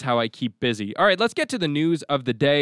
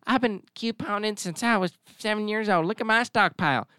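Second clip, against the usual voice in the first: higher, put-on stockpiler voice; mock couponer; 8 semitones higher than usual. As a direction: play a proud coupon hoarder, pitched up. Mocking extreme couponers with a quote.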